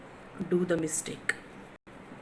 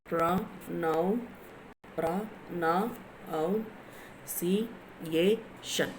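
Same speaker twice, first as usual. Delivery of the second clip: slowly, a gap after each sound; teacher sounding out a word piece by piece; syllable by syllable; 5 dB quieter, slower than usual. Shown as a demonstration. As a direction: break the word into separate syllables. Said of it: "when you will spell slowly".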